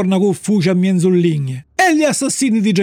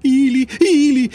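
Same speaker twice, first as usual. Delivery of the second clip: higher, slow, drawn-out alarm; alarmed thought; 5 semitones higher, slower than usual. A narrator's reading. Puppet spots two dark figures following him, alarmed. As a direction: stretch both words out in a raised voice. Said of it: "pensò".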